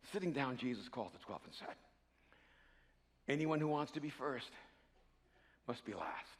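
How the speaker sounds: low, very soft sermon voice; grave, intimate scripture telling; hushed, solemn preaching. Acted. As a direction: draw the room in quietly.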